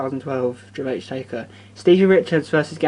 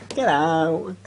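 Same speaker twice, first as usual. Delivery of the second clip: imitating Sean; 7 semitones higher, slower than usual. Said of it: "Sean went".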